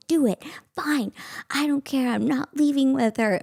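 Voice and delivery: retold tipsy, sassy refusal; sassy defiance. Drunk teen to police about leaving with mom, sassy defiance. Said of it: "really sassy".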